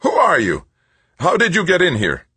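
high, loud, startled demand; squeaking shocked surprise. A narrator's reading. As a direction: fire questions in a squeak of alarm. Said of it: "squeaking with shocked surprise".

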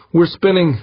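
high, loud cry of alarm; alarmed shout. A narrator's reading. Pilot to captain as the ship spins, high alarmed shout. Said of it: "the pilot shouted".

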